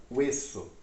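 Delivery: "hueso" begun on a W glide, no H; silent H. Shown as a demonstration. Teacher shows learners the H has no sound, silent H in "hueso". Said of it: "Sabe que o H não tem".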